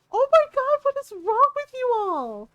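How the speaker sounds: high-pitched, appalled scolding; scandalized exclamation. Retold or imitated. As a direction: retell it as outraged disbelief. Appalled reaction to dad's prank.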